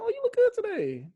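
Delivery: hammed-up high-pitched flattery; mock gushing compliment. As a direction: gush it like a flirty friend.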